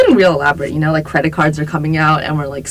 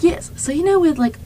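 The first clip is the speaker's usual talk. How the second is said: high-pitched, badly faked British; attempted British accent; 11 semitones higher than usual. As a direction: imitate a British friend, not very convincingly. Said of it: "I can't keep going with this".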